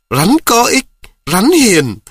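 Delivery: put-on sneering singsong of a boy; mocking. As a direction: parrot his words back in scorn. Mocking the friend's own words.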